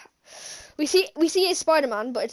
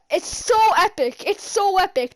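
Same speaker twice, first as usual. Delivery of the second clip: drawn-out, emphatic rave; thrilled fan raving, stretching the words; excited gushing; 6 semitones higher, 5 dB louder than usual. Real, not acted. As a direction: gush with rising excitement. Gushing over the fight scene.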